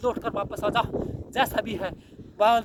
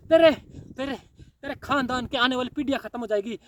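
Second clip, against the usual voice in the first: ordinary delivery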